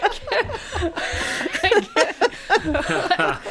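high laughing give-up; laughing helplessly. Real, not acted. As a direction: laugh through the words. Laughing at her own struggle.

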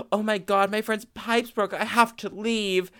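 high, hammed-up alarm; fake panic. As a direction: overact a sudden emergency. Faking an emergency to escape.

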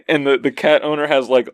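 ordinary talk

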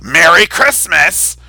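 slow, insistent mock shout. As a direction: bark it back like an insistent zealot. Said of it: "And they're like".

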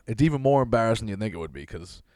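ordinary talk, nothing marked

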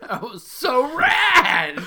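loud, high, laughing; laughing shout. Real, not acted. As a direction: shout it while laughing hard. Laughing memory of party.

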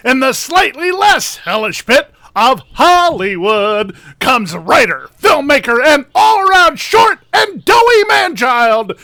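loud booming ring announcer intro. Acted. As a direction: introduce him like a main-event fighter. Ring-style guest introduction.

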